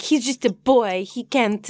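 lightly voiced French accent, mock pity; a hint of a French accent as Fleur. Recapping Fleur at the judges, light French accent. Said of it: "this little French accent".

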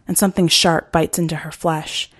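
ordinary delivery

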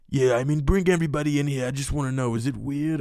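a loose, half-hearted Trump voice; bad Trump impression, barely put on. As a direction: toss off a lazy Donald Trump bit. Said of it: "a question Trump would ask".

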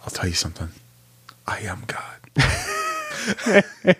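dramatic menacing movie line; Alec Baldwin quote. Retold or imitated. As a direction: deliver it like a cold movie villain. Quoting Alec Baldwin Malice line.

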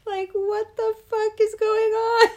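high, loud laughing yelp of disbelief; shocked giggle. Retold or imitated. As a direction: squeal it out while cracking up. Quoting cartoon line, laughing.

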